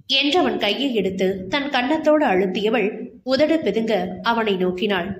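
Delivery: usual speaking voice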